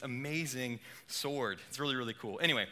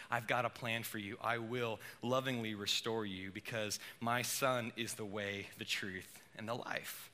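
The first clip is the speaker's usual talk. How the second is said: loving God; 5 semitones lower, 6 dB quieter than usual. Acted. God's loving words voiced.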